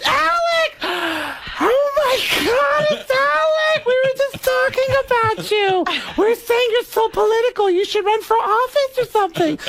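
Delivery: high, gushing starstruck woman's voice; Beverly D'Angelo impression. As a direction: squeal it out, thrilled to spot Alec. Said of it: "Beverly D'Angelo, completely clueless, gets off the elevator and goes".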